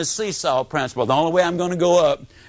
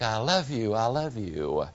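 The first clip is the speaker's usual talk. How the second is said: lower, softer echo fading away; distant echo; 7 semitones lower, 8 dB quieter than usual. Retold or imitated. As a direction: let it drift back faint and far off. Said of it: "the echo came back".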